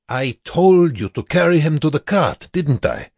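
loud, high-pitched scolding; petulant. A narrator's reading. Guard whose order was ignored, loud high petulance. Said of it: "the guard said petulantly".

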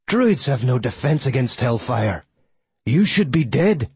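incredulous villain's outburst, rising in pitch; indignant character voice, climbing at the end; erupting in disbelief. A narrator's reading. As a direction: protest it, outraged that your attack failed. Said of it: "he erupted".

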